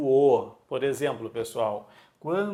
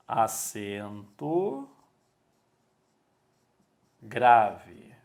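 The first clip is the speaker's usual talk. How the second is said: very slow, long pause between words; slow dictation; 4 semitones lower, much slower than usual. Real, not acted. Teacher writing the accent's name for pupils, slow dictation. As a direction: draw each word out while writing it.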